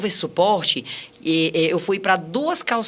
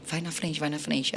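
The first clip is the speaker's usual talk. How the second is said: hushed urging; 3 semitones lower, 10 dB quieter than usual. Retold or imitated. Sneaking into the hotel quietly.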